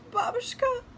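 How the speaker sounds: put-on high, tearful little-girl cry. A narrator's reading. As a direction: call for grandmother through mild sobs. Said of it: "sobbed Natasha".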